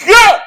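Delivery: high, loud exclaimed yell; explosive high-pitched whoop; sudden yelp. Pretend. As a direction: burst out with a high shout. Exclaims after describing a sudden hit.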